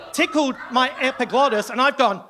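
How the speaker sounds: normal talking voice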